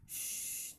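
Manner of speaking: a soft voiceless sh; whispered sh. Shown as a demonstration. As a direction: breathe a gentle sh. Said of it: "this is the sh sound".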